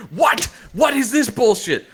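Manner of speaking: high, loud, incredulous outburst; mock outrage. Retelling almost throwing the comic down, mock outrage. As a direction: cry it out in exaggerated disbelief. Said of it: "I almost threw this comic to the ground like".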